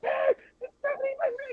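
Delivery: high fangirl squeal; starstruck squeal. Retold or imitated. Telling friend how she reacted to Stephanie McMahon, a high starstruck squeal. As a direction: squeal like meeting your idol up close.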